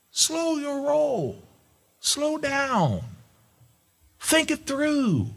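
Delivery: high, slow pulpit warning; preached warning. Acted. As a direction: plead with the flock to wait. Preaching the catchphrase.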